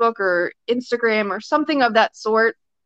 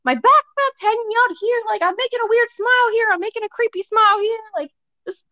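much higher, whiny self-critical voice; mock dismay; 13 semitones higher than usual. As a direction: imitate yourself cringing at the video. Retelling her own upset reaction.

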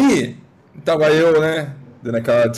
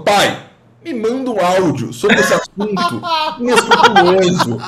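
laughing; 5 semitones higher, 3 dB louder than usual. Retold or imitated. Mock request to dad, laughing.

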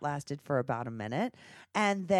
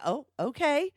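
higher, louder mock surprise; surprised; 10 semitones higher, 4 dB louder than usual. Real, not acted. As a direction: pretend shocked agreement. Reacting to co-host joke.

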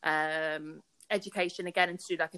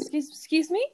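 high incredulous excuse me; mock disbelief; 8 semitones higher, 4 dB louder than usual. Stranger hearing she is an actor, mock disbelief. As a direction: act shocked at the answer. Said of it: "And they're like".

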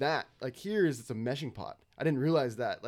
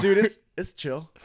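imitating a laid-back dude; 5 semitones higher, 8 dB louder, slower than usual. Imitating surfer talk.

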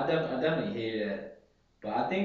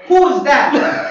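high, loud, slow suspicious demand; mock mom yelling about a midnight text, much higher and louder; nosy mom; 13 semitones higher, 17 dB louder, slower than usual. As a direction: play a mom catching a late-night text. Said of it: "Oh, hold up, mom".

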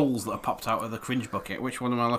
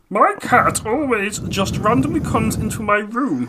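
higher, louder put-on voice; put-on reading voice; 8 semitones higher, 4 dB louder than usual. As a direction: read it in a silly performed voice. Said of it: "I'll read it in my normal voice".

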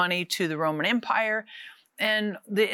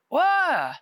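sudden high startled whoa; a quick jolt of surprise; surprised whoa; 8 semitones higher, 4 dB louder than usual. Retold or imitated. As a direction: caught off guard, blurt it out. Flipped script, free fall.

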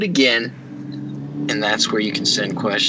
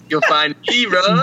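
laughing; 6 semitones higher, 5 dB louder than usual. Real, not acted. Joking about living in closet.

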